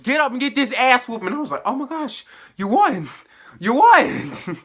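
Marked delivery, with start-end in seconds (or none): 0.0-1.3 high, loud imitation of a brawler; mock trash talk. Misty beating Nandy in the retold brawl, mock yell. 1.6-4.6 high, loud retold exclamation; excited cheering. Viewer reacting to Misty's beating, excited high cheer.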